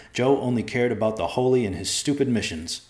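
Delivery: plain reading voice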